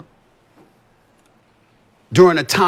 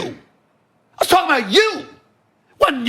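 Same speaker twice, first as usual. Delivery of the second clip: shout; 10 semitones higher, slower than usual. Real, not acted. Host shouting at LeBron that he meant him. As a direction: yell it straight at him, fed up. Heated rant aimed at LeBron.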